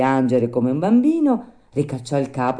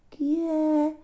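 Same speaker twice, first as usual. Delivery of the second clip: very high little voice; tiny voice. A narrator's reading. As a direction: call from inside in a tiny squeak. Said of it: "disse una vocina di dentro".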